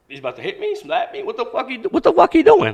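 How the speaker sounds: retold panic shooting up high; alarmed. Kid expecting a slap from gang leader, alarmed.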